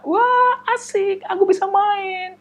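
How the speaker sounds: squealing with joy, high and loud; a kid thrilled to play; slow delighted child's cheer. Acted. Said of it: "Napoleon senang sekali".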